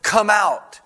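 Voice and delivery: high, forceful command; commanding call. Acted. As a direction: call it out with authority, a little short of a shout. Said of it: "He just says the words".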